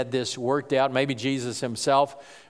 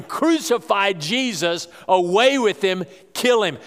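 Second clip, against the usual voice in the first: higher, emphatic mock shout; retold crowd shout; 5 semitones higher, 3 dB louder than usual. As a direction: punch out each demand like a mob, without screaming. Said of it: "be shouting".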